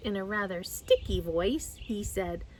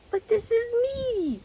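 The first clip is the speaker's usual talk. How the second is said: much higher, startled protest; very surprised; 13 semitones higher, 5 dB louder than usual. A narrator's reading. Pooh told he is away, answers high and surprised. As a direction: blurt it out in disbelief. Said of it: "very much surprised".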